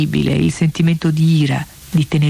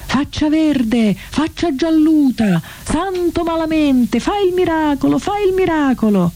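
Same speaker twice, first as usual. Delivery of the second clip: very high, slow, drawn-out insults; a crowd's anguished howl, stretched out and high; desperate screams; 11 semitones higher, slower than usual. A narrator's reading. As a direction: shriek at the saint in anguish. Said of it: "scoppiarono le grida".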